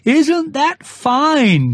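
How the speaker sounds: high, slow shouted question; shouting down. A narrator's reading. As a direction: call it down proudly from the sky. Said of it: "shouted Winnie the Pooh down to you".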